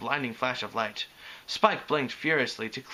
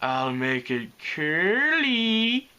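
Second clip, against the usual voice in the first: higher, drawn-out coaxing; cajoling; 4 semitones higher, 4 dB louder, much slower than usual. A narrator's reading. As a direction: coax sweetly, stretching the words. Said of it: "she cajoled".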